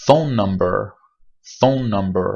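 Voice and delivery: phone number with one long held n; geminated phone number. Shown as a demonstration. Teacher modelling a long n in phone number. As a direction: hold the n across phone number. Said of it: "چند تا مثال بزنیم".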